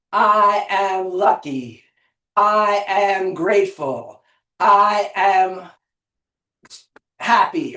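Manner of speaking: slow, evenly spaced incantation; rhythmic affirmation. Shown as a demonstration. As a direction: repeat it steadily, stretching each word. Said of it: "three more".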